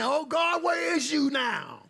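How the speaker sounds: high, drawn-out mock wail to God; desperate cry. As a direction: cry out long and high, imitating a desperate prayer. Acting heathens crying out in prayer.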